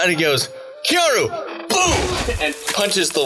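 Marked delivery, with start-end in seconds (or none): 0.9-1.3 high-pitched battle call; attack cry. Acted. Father punches with icy fist, crying out high. 1.7-2.4 high, punchy vocal boom; imitated impact boom. Game master sounds the punch hitting, booming high.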